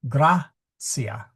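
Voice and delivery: "Grazia" stressed on "Gra", GRAHT-see-a; stress on first syllable. Shown as a demonstration. Teacher showing students first-syllable stress on Grazia. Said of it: "in order to accent it".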